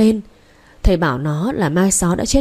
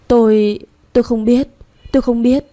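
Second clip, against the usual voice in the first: higher, louder stammered plea; frightened denial; 4 semitones higher, 3 dB louder than usual. Acted. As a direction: deny it in a rising panic. Terrified denial to a ghost.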